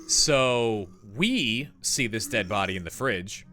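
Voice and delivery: ordinary talk, nothing marked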